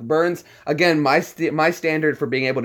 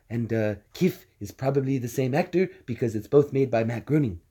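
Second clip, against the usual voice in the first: usual speaking voice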